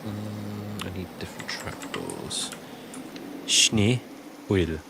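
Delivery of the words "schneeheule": very slow "Schneeheule", split into shnee and hoy-luh; practised pronunciation. Shown as a demonstration. Streamer practising the German word alone, slow careful pronunciation. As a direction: draw out "Schneeheule" with a gap before hoy. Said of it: "So is it like".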